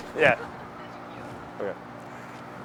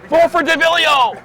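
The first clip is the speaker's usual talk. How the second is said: campaign shout; 9 semitones higher, 5 dB louder, slower than usual. Acted. Acted campaign shout in scene.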